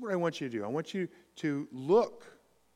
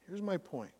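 the same words again, much softer; quiet repeat; 3 semitones higher, 8 dB quieter than usual. Real, not acted. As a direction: drop your voice to let the point sink in. Preacher repeats key point.

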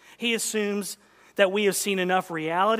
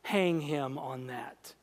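cold slow command; 4 semitones lower, 5 dB quieter, slower than usual. Acted. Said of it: "And the king said".

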